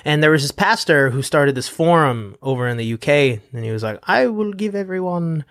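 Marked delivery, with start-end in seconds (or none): 4.0-5.4 put-on higher-pitched, wobbly British accent; attempted British accent. Imitating a UK forum founder, shaky British accent. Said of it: "trying to access my British accent".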